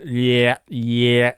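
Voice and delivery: yeah with the vowel held long and level; drawn-out "yeah" in an upper-Midwest accent; elongated Midwest vowel. Shown as a demonstration. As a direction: stretch yeah out, North Midwest style. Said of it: "extra elongation of the vowels".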